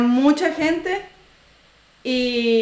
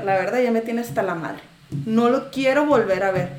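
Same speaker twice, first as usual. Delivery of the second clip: ordinary delivery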